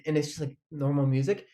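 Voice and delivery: ordinary delivery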